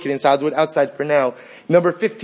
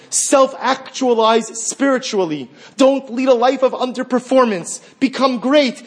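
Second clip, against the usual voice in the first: Gehenna orders you to become great; Gehenna's command; 8 semitones higher, 3 dB louder than usual. Acted. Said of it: "Gehenna says".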